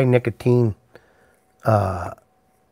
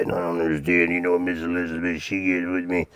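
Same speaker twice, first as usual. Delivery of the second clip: low, soft imitation of a wrestler's growly boast; Macho Man Randy Savage impression; 6 semitones lower, 8 dB quieter than usual. Imitating how the wrestler talks off stage, low and soft. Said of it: "He talks like that".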